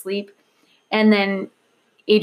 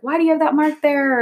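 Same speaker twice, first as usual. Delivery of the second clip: put-on toddler asking; 7 semitones higher, 3 dB louder than usual. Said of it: "And he's like".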